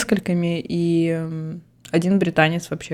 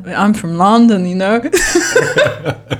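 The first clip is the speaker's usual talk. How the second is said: put-on louder, laughing London accent quote; imitating the Londoner's accent with a laugh; mock Londoner; 3 semitones higher, 5 dB louder, much slower than usual. Quoting the London date on her accent, laughing in a put-on London accent. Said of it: "но я как бы из Лондона".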